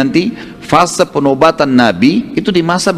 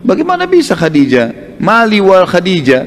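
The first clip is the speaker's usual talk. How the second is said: incredulous; 6 semitones higher, slower than usual. Acted. Prophet astonished at the proposal.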